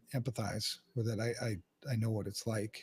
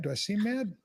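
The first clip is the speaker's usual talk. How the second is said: raised voice; 8 semitones higher than usual. Real, not acted. Defending himself to his cohost, voice raised and higher. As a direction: protest that he isn't mad with a raised voice. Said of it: "You're raising your voice at me".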